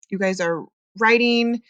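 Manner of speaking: usual speaking voice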